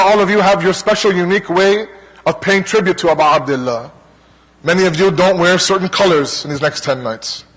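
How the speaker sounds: normal talking voice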